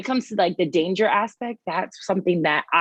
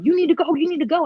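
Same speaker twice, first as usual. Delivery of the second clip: higher, urgent imitated plea; friends urging; 9 semitones higher, faster than usual. Friends hurrying her away from the gunman, urgent. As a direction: push her out the door fast. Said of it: "his friends ... was like".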